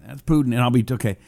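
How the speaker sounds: ordinary talk, nothing marked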